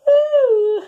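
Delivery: high, loud stretched waking ooh; a big high wake-up ooh. Acted. Spider waking up from her nap with a high ooh. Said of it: "went Spider".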